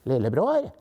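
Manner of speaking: big brother's in-between call; half-deep, half-light voice. A narrator's reading. Big brother Philip calls lillebror, half-deep, half-light voice. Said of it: "en stemme som var litt dyp".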